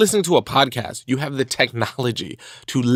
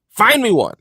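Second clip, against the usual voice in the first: higher, louder dare; louder challenge; 9 semitones higher, 7 dB louder than usual. Shown as a demonstration. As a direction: throw down the challenge. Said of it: "challenge repeated louder".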